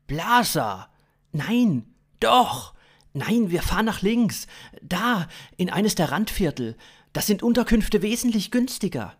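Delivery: high, loud back-and-forth squabble; heated contradiction, hammed up; acted bickering. Acted quarrel over where to drive.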